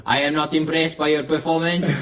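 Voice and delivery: lightly mock a French-Canadian fighter's accent; George Saint Pierre. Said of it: "your inner George Saint Pierre".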